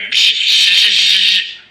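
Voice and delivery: loud high burst of laughter; laughing. Real, not acted. Man laughing at the officer's name, loud laugh. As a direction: cackle at the name.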